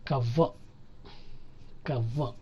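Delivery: కవ్వ ending on a cut-short "uh"; clipped schwa. Shown as a demonstration. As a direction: say కవ్వ as "KUH-vuh", r silent. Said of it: "cut చేస్తాం అనమాట".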